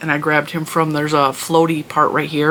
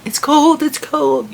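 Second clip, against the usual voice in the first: high-pitched mock child complaint; whiny little kid; 10 semitones higher, slower than usual. Mom mimicking little kids at the cold pool, whiny. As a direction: imitate a kid balking at cold water, much higher. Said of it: "You know how little kids are".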